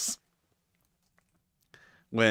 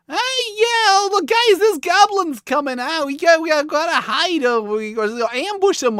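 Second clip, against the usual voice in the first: much higher, louder goofy adventurer voice; squeaky, silly party member, eager to stab; goofy little dude; 6 dB louder than usual. Retold or imitated. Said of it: "like a goofy little dude".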